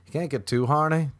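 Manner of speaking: ordinary talk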